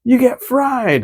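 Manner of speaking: drawn-out high victory line; triumphant one-liner. Retold or imitated. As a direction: savour the gloat like a cartoon hero. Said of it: "he gets one of those projectiles".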